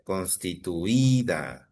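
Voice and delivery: "constituida" drawn out slowly on the stressed Í; slow right down on "constituida", holding Í before -da. Shown as a demonstration. Teacher repeats the rule, "constituida" slow with stressed Í. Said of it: "si es una palabra grave".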